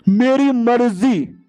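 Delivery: high, drawn-out defiance; defiant declaration. Acted. Hero telling uncle he does as he pleases, defiant.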